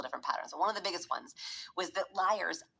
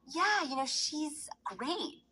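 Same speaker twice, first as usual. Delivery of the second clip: much higher, forced cheerful yes; fake enthusiasm; 11 semitones higher, much slower than usual. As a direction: gush a little too brightly to hide dislike. Fake praise of new girl.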